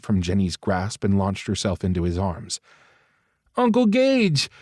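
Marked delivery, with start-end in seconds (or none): 3.6-4.5 put-on high, louder small child's voice; little girl with a sniffle. Little niece running to her uncle, high child voice. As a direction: sound like a tearful little girl. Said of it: "Molly asked with a sniffle".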